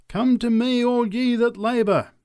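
high, loud calling out; preacher's cry. A narrator's reading. Preacher crying out to the crowd. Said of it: "cried the preacher".

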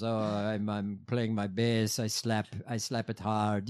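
low, lightly imitated Italian accent; Davey's Italian accent. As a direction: put on an Italian bass player's accent, keeping it low. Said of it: "He has an accent".

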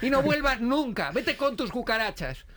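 high, drawn-out comic indignation; mock indignant banishment. Throwing the date out over his cat, slow mock indignation. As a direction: banish them slowly with mock outrage. Retold angry eviction of the date.

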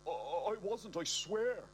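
high, stammering and scared; nervous denial. Acted. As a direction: stammer it out, panicking. Panicked denial to fireman.

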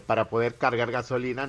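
ordinary talk, nothing marked

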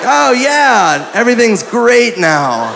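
high, mock-enthusiastic agreement; sarcastic. Doubter answering his buddy about the spa sign, sarcastic. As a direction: feign relief with exaggerated pitch.

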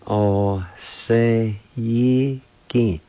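"O se yi ki" very slow, each syllable held; slow syllable demo. Shown as a demonstration. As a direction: stretch "O se yi ki" syllable by syllable. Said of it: "is pronounced like this".

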